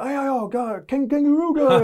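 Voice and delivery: put-on high, excited fan chatter with a broken accent; a hammed-up foreign fan yelling kangaroo; mimicking foreign fans. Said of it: "They would go".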